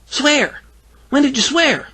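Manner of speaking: put-on high-pitched little-girl chirp; chirpy. A narrator's reading. Greta questions Bill about swearing, high chirp. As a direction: chirp it like a puzzled child. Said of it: "She chirped back at me".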